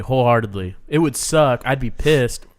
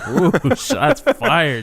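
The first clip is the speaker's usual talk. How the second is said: laughing; 7 semitones higher, 4 dB louder, much slower than usual. Real, not acted. Reacting to a jab at Beza.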